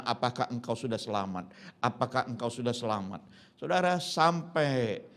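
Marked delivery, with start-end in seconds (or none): 0.0-3.2 low, hushed asking; soft earnest question. Retold or imitated. John Harper asking lifeboat passengers, soft low question. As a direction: ask it softly, pitched down.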